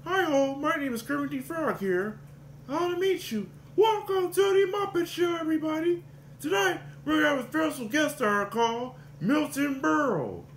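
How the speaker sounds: high, nasal-free frog puppet voice; Kermit the Frog impression. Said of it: "The other one is Kermit".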